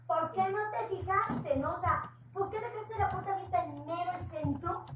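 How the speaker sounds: an angry scold, pitched high; retold angry scolding. Wife scolding Beto over the stolen stereo, angrily. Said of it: "yo estaba bien enojada".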